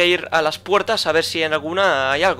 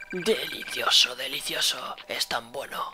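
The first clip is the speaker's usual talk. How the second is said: softly savouring monster voice, drawn out; gloating monster; 3 semitones lower, 9 dB quieter, slower than usual. Acted. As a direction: relish each word like a feeding creature. Monster relishing its meal. (voz de monstruo saboreando su presa)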